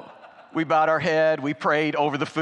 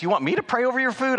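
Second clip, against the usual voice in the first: high and fast, caught off guard; a mock startled question; imitating the waitress's shock; surprised waitress; 11 semitones higher, 4 dB louder, much faster than usual. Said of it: "she says".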